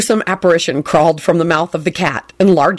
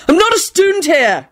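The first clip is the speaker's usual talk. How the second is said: hollering; 10 semitones higher than usual. Acted. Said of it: "Ron hollered after him".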